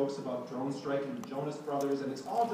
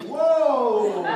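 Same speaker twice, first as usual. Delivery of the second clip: mock startled whoa; 9 semitones higher, 14 dB louder than usual. Leno reacts to the shove.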